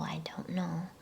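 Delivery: usual speaking voice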